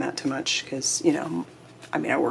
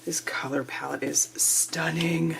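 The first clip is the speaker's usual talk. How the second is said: awed whisper; 7 dB quieter than usual. Real, not acted. Awed whisper over paper.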